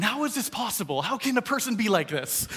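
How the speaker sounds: high-pitched exasperated rant; mock outrage, voice climbing in frustration; worked up. Dad fed up with his picky eater, worked-up high protest. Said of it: "I'm all worked up going".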